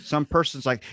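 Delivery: usual speaking voice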